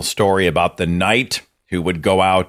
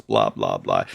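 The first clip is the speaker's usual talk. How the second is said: dismissive blah; 14 semitones lower, 5 dB quieter than usual. Real, not acted. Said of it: "dismissive filler".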